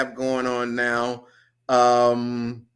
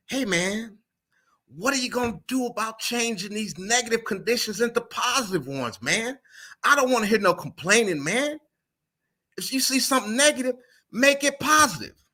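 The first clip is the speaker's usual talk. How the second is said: higher-pitched imitation of a professor's New York accent; 8 semitones higher than usual. Remembering his grad school professor over chess, New York accent. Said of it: "he had this thick New York accent".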